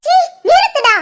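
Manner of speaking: high, disgusted command; angry cut-off. Acted. Mother cutting off her son's threats, angry cut-off. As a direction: stop him short in anger.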